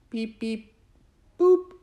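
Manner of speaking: high robot beeps, last one loud. Pretend. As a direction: chirp like a little robot. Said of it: "Do it like a robot".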